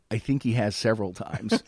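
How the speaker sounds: ordinary talk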